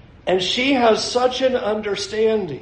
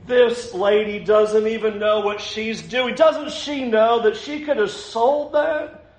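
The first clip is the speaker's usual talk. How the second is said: higher-pitched, mounting mock outrage; indignant; 4 semitones higher than usual. Disciples complaining about the woman's waste at table, indignant. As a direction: act out scandalised disbelief, slowing on the last words. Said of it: "it says they were indignant".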